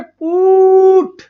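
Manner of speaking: पुट with a long stretched ऊ vowel; drawn-out put. Shown as a demonstration. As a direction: stretch पुट slowly, holding the ऊ. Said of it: "तो देखो sound कैसे आ रही है".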